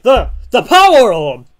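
loud, high cry of alarm; alarmed shout. Acted. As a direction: shout to ward off a villain. Hero panics over power orb.

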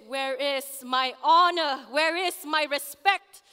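loud, demanding challenge; angry reproach. Retold or imitated. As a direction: thunder it out as an accusing demand. Quotes his angry reproach.

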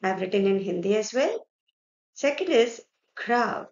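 ordinary talk

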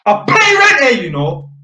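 sudden high, loud outburst; indignant high-pitched yell; snapped shout. Retold or imitated. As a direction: explode at full volume. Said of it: "and my man snapped".

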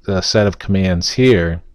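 normal talking voice

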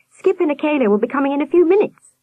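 put-on quick, high boy's answer; hasty reply. A narrator's reading. Sam reassuring the nurse, quick high reply. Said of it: "he said quickly".

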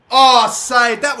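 loud burst of joy; excited cry. Real, not acted. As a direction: call out the save, thrilled. Keeper saves penalty.

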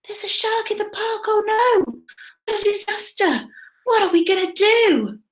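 high-pitched storybook alarm; mock panic. Reader acts out the shark scare for children, mock panic. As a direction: fret in a high voice over a disaster.

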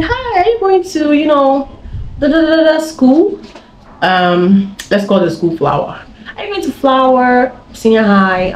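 high friendly stranger voice; high sweet voice. Retold or imitated. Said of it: "They're like".

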